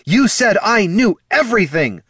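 high, loud accusation; outraged yell; bellowing. Acted. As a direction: bellow it in fury. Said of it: "the Solomancer bellowed".

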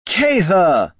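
a bright excited call from afar; calling out loud. Acted. Agent hailing Catha across the plaza, loud high call.

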